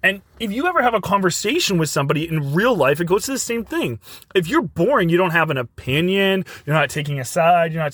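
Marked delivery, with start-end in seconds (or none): none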